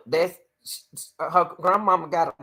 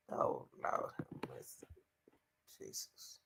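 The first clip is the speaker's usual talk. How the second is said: whispered prayer of disbelief; stunned whisper; 21 dB quieter than usual. Real, not acted. Viewer reacts to the aunt's story, whispering in disbelief. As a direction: breathe it out like a shocked prayer.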